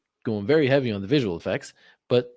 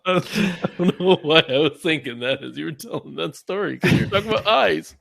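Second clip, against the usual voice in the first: amused, laughing as the words come out; 4 semitones higher, 4 dB louder than usual. Real, not acted. Cracking up after telling a silly meme, laughing. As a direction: talk through real laughter, a bit higher.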